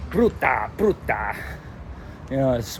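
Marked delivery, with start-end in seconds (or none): 0.1-1.3 imitated Roman Italian accent, brutal and rough. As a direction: punch out each word like a rough Roman. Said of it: "the Roman Italian is more".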